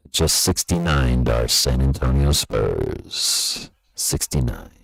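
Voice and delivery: very low, slow comic announcer voice; deep joke voice. Pretend. Streamer reading the score as a joke, very low slow voice.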